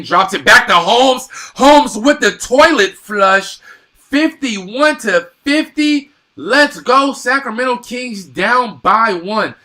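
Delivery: loud, high-pitched hometown basket call; excited play-by-play. Real, not acted. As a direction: erupt as the home team scores. Live call of a home basket.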